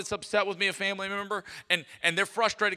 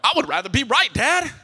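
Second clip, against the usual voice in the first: put-on higher and louder, young son talking back; defiant retort; 7 semitones higher, 9 dB louder than usual. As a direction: stake your claim, loud and high.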